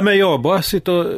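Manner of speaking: usual speaking voice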